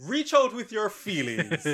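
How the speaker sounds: giggling out the line; laughing through the quote. Retold or imitated. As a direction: quote it while cracking up. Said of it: "He said something afterwards that had me and Douglas laughing".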